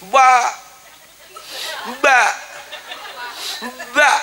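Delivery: single drawn-out ba syllables; saying ba to a baby. Shown as a demonstration. Mother repeating ba to teach her baby, spaced and drawn out. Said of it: "Sepuluh kali nyebut ba".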